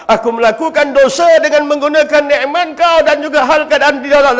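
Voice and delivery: ordinary delivery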